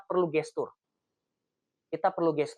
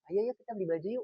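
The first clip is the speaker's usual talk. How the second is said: softer coaxing invite; gentle invite; 3 semitones higher, 4 dB quieter than usual. Shown as a demonstration. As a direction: coax her kindly, a little softer. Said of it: "Yang pertama tadi atau yang kedua".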